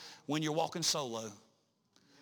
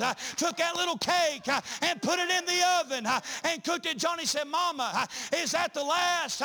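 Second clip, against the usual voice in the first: full-force preaching; 9 semitones higher, 4 dB louder than usual. Acted. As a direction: preach the story to the congregation. Preacher acting out widow story.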